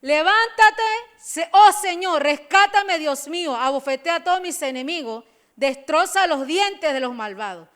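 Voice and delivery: fiery preaching. Acted. Preacher exhorting the congregation, loud and fired up. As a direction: preach it out to the whole church.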